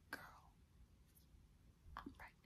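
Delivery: barely audible, voiceless whisper; tearful whisper. Retold or imitated. Confiding in the cool lady, tearful whisper. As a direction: whisper it as if too tearful to speak. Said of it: "I'm whispering, but I'm crying".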